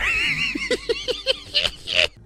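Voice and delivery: high-pitched squealing giggle fit; squealing laugh. Real, not acted. Host cracking up at own bit, squealing laugh.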